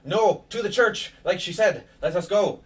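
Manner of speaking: Ismark urging. Acted. Ismark holds her back from the fight, urgent insistence. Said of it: "and says".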